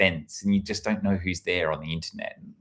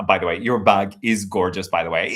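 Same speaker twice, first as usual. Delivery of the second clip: ordinary talk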